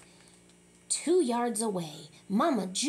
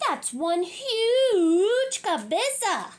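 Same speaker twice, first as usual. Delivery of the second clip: high, slow, louder wailing cry; shocked wail; 7 semitones higher, 5 dB louder, slower than usual. Acted. Kitty boy wails over his huge head in the mirror. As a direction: cry out in comic dismay.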